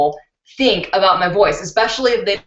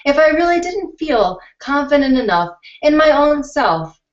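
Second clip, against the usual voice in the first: put-on higher voice; higher, put-on YouTube voice; altered voice demo; 6 semitones higher, 3 dB louder than usual. Shown as a demonstration. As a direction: show the fake voice she could use. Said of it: "I can alter my voice like this".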